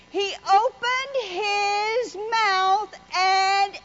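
slow, drawn-out preaching cadence over music; sermon voice rising over the organ. Acted. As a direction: preach it slowly and deliberately.

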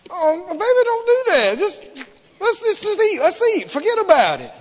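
high-pitched, pleading brush-off; flustered placating man. Retold or imitated. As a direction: imitate a hapless boyfriend calming her down. Voicing Samson soothing his crying lover.

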